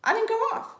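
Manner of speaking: squeaky high. Real, not acted.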